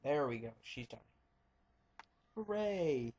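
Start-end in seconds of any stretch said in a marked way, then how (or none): 2.3-3.1 high sing-song hooray; mock cheer. Real, not acted. Gamer celebrating the win over Peach, high mock cheer.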